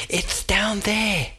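high, slow derby voice. A narrator's reading. The derby tells Oscar, slow and high. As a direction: point it out slowly.

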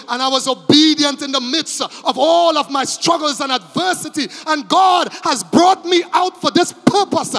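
loud, high, ringing sermon delivery; full-force preaching. Acted. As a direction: preach it with everything.

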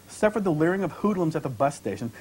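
usual speaking voice

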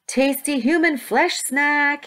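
high, slow, sing-out call; yelled bait. A narrator's reading. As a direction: yell it like a vendor luring customers. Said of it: "he yelled".

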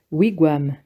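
ordinary delivery

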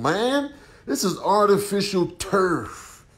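ordinary talk, nothing marked

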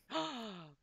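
sharp excited gasp; loud gasp. Real, not acted. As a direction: gasp loudly at a discovery. Sudden discovery in game.

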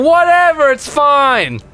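very high, loud dismissal; exasperated shout. Real, not acted. Player dismisses friend's grenade blunder, shrieked brush-off. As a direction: yell it off defensively.

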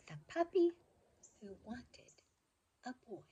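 put-on bright, lively storybook reading for kids; reading to children. A narrator's reading. Reading a favorite childhood book to children, lively storybook voice. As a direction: read it like a bedtime picture book, full of expression.

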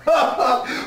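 short high delighted laugh; gleeful laugh. Real, not acted. Preacher laughing at his own taunt, gleeful laugh. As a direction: burst out laughing at the devil.

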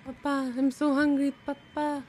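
put-on high pleading kid voice; whiny begging. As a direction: beg Papa like a hungry child. Kid begging dad for chips.

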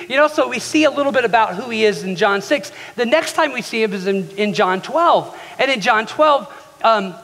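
usual speaking voice

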